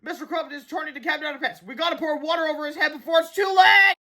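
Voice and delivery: high, panicked warning building to a shout; urgent cry. A narrator's reading. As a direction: rush to stop a disaster. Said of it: "cried George".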